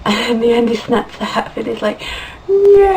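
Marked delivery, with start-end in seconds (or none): 2.5-2.9 long, high, loud mock wail; hammed-up drawn-out howl of despair; anguished cry. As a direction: cry out as if all is lost. Acts out character's anguished cry.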